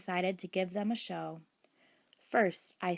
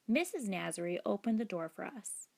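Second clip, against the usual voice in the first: plain reading voice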